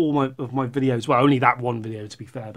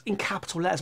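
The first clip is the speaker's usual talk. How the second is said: quick drop to a soft voice; quiet aside; 4 semitones higher, 7 dB quieter, faster than usual. Real, not acted. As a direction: toss it off softly. Same words repeated as quiet aside.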